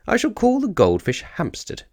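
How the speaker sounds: high, pleased little bear voice; a hint of a proud young bear; lightly voiced Paddington. A narrator's reading. Paddington naming his prize goldfish, lightly voiced.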